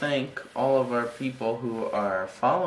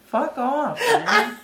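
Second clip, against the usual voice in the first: laughing mock offense; 14 semitones higher, slower than usual. Real, not acted.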